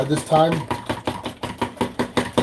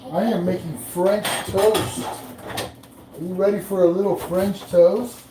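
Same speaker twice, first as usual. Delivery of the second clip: slow, high sing-out to the house; playful announcement; 5 semitones higher, slower than usual. Pretend. Host offering French toast to the household, playful announcement. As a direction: stretch it out, higher than usual.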